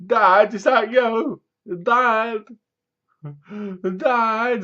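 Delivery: put-on high, hesitant calls of a lost boy; boy calling for dad. Voicing hiding boy calling out.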